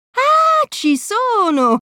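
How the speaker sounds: hammed-up villain getting an idea; villain's eureka. A narrator's reading. Space pirate Pete hatches his plan, hammed-up eureka. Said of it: "chiede Pietro".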